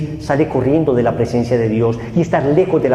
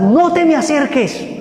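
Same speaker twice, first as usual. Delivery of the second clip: shouted refusal; 13 semitones higher than usual. Retold or imitated. Addict yelling at the preacher to stay away. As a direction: push him away with a yell. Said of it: "el grito que me dio".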